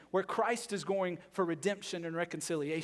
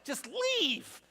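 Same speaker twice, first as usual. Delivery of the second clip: exasperated rant; 8 semitones higher, 3 dB louder than usual. Retold or imitated. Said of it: "That's like my response".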